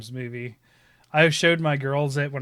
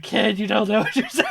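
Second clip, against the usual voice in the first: higher, laughing through the words. Retold or imitated. Dad to his toddler, half laughing. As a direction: choke out the line between laughs. Retells his reply to daughter.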